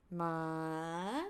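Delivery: "ma" dipping low, then climbing; rising tone. Shown as a demonstration. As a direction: glide "ma" from low to high. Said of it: "rising from low, low to high".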